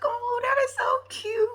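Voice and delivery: high, drawn-out coo; cooing gush. Retold or imitated. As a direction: gush in a slow, high-pitched aww voice. Gushing reaction to sweet moment.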